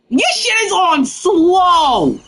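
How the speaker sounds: mock high whine. Real, not acted. Gamer mocking a slow jump shot, high whine. Complaining at game settings.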